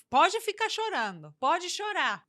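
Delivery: mock impatient parent leaving the child to cry; dismissive parent. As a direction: wave the crying child away.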